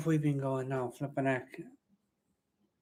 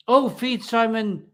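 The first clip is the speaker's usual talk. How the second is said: ordinary talk, nothing marked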